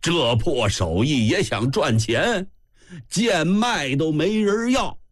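scornful call after someone, rising high; acted taunt climbing in pitch, without real shouting; called-out jeer. A narrator's reading. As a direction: call mockingly at his departing back. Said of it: "叫道".

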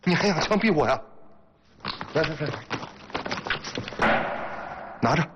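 very high-pitched, defiant retort; angry challenge. Acted. Husband daring his wife in a quarrel, angry challenge. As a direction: throw the threat straight back. Husband defies wife's threat.

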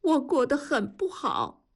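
high, upset confession; narrator's lightly acted tearful woman; trembling lips. A narrator's reading. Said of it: "方红兵的嘴唇哆嗦着".

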